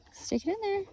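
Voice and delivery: very high quick come-on to a puppy; high coaxing. Real, not acted. Owner coaxing Cora to try, very high. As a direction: lift the voice way up to coax. Teaching dog a trick.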